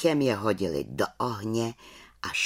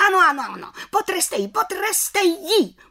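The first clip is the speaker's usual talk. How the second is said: taunting witches. Acted. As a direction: goad her on like a gleeful mob. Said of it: "popichovaly i ostatní čarodějnice".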